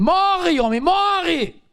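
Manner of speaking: high, slow, dragged-out wife urging death; wife's mock cry. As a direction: wail it like a scornful wife. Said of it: "a mulher dele disse assim pra ele".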